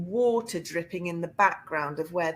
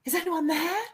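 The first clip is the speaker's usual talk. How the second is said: calling like a spirit; 12 semitones higher than usual. Retold or imitated. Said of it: "Like I was the spirit".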